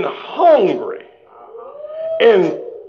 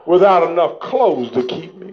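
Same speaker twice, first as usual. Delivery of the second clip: ordinary talk, nothing marked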